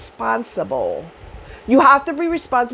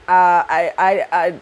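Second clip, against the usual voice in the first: real stammer from shock; stuttering; 3 semitones lower, slower than usual. Real, not acted. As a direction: stumble over the words. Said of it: "I'm stuttering".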